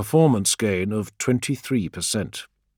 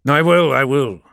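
louder, brushing it off; dismissive; 7 semitones higher, 9 dB louder than usual. A narrator's reading. Leader brushing off his adviser's worry, dismissive. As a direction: wave the concern away. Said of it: "swiftly dismissing Org's concerns".

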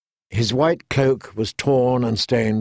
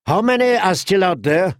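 shouting; 7 semitones higher than usual. A narrator's reading. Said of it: "he shouted to no one and everyone".

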